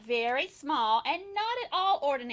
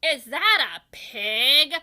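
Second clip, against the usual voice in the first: louder, slow suspicious demand; disapproving question; 2 semitones higher, 9 dB louder, slower than usual. A narrator's reading. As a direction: sound appalled at the pig. Disapproving neighbour sees pig.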